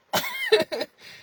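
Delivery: sharp high-pitched giggle. Real, not acted. Laughing at own bit.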